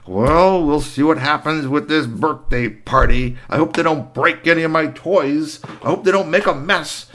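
a lightly voiced fretful witch; worried old shopkeeper muttering; fussy witch. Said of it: "She said".

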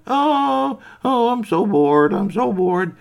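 high, slow whiny moan; bored whining toys. Acted. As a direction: drag it out like a bored doll.

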